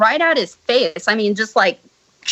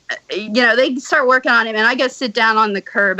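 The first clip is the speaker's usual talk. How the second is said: ordinary talk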